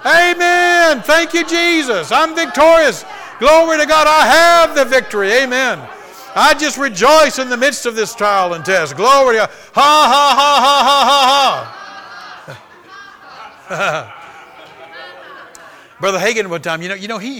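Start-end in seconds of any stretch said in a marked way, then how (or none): 0.1-9.5 high, very loud jubilant shouting; triumphant shouted praise. Shown as a demonstration. Demonstrating victorious rejoicing at trouble. 9.7-14.2 high, very loud deliberate laughter; triumphant forced laugh. Shown as a demonstration. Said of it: "why don't you just laugh at it".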